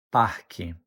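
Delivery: "parque" with no hard R, like PAH-kee; regional variant. Shown as a demonstration. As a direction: soften the R away in "parque", low falling tune. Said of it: "you might also hear it pronounced as".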